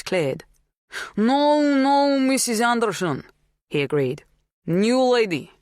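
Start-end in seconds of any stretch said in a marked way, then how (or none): 1.2-3.2 put-on high, loud Polish-accented agreement; strong foreign accent. A narrator's reading. Polish farmhand catching on at last, strongly accented. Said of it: "His accent was strong". 4.7-5.5 put-on higher, louder Polish-accented voice; strong foreign accent. A narrator's reading. Polish farmhand naming the newcomer, strongly accented. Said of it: "His accent was strong".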